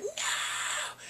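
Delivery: very high, strained squeal of "no"; mock crazed cry. Femme seeing the secretary hug him, mock crazed wail. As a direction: imitate a freak-out on one word. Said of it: "He goes crazy".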